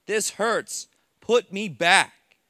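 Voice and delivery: loud, slow, pleading yell; screaming from the hospital bed in pain; retold screaming. Patient screaming at the staff to be put back, loud and slow. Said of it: "I'm screaming at them".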